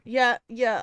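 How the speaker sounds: plain reading voice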